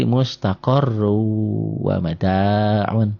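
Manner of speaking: slow recited "mustaqarru wa mada'un", gliding from ru into a held w; tanwin merged. Shown as a demonstration. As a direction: drop the n of "mustaqarru", stretch the join into "wa mada'un". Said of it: "ru-nya masuk ke sini".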